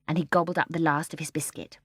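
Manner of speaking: usual speaking voice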